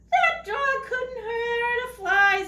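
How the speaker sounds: high, slow singsong; singsong burglar. Acted. Burglar dismissing the friendly dog, high singsong. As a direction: coo it up high, mocking the dog's danger. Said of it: "said one of the burglars".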